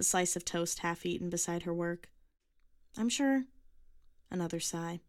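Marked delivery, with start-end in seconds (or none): 2.9-3.5 put-on young girl voice.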